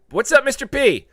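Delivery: high, loud hype greeting; loud high hello. Real, not acted. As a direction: shout out the viewer.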